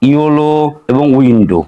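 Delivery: ইওলো and উইন্ডো said slowly with a long final o; slow model words. Shown as a demonstration. Teacher to students, modelling the o sound slowly. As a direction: draw out the o ending of ইওলো and উইন্ডো. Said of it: "যেমন আমরা এখানে দেখতে পাচ্ছি".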